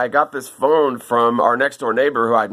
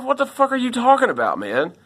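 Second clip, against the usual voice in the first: freaked out; 11 semitones higher than usual. Retold or imitated. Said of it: "she's like".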